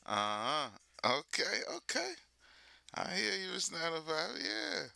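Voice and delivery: high-pitched run of own sayings; signature catchphrases. Shown as a demonstration. Streamer mimicking sounds uniquely theirs, signature catchphrases. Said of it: "sounds that are uniquely you".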